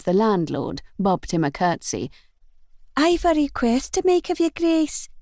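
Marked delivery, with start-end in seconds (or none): 3.0-5.1 put-on young maid, pitched up in broad Scots; a maid begging a favour in broad Scots; broad Scots accent. A narrator's reading. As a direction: ask the Duke politely in broad Scots. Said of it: "she said in a broad Scots accent".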